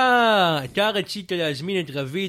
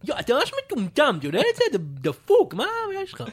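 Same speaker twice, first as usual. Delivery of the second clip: heated argument; 4 semitones higher than usual. Acted. Fake rapper insulting the host in a heated argument. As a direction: snap back at him, offended.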